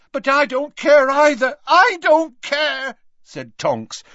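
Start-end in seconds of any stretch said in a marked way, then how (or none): 0.1-2.9 desperate shout. A narrator's reading. Tonks shaking Lupin's robes, desperate shout. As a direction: shout it while shaking him. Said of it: "shaking them".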